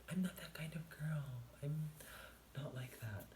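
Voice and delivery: high, airy protest; mock coy. Trying to seem not that kind of girl, mock coy. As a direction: protest lightly up high, softening at the end. Acting coy about the cute cashier.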